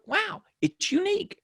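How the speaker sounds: high-pitched, marvelling wow; wondering delight. Retold or imitated. Speaker now embracing the voice he once hated, wondering delight. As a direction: lift it high in pleased wonder. Retold new delight in his voice.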